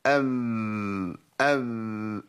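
ام with loose lips, almost a م; slack lips demo. Shown as a demonstration. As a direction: relax the lips so ب drifts toward م. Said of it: "لو ترخيت في اطباق الشفتين".

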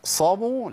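أقصموا with a heavy emphatic ص; أقصموا said with ص instead of س; wrong emphatic sad. Shown as a demonstration. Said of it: "لا". (أقصموا بصاد مفخمة)

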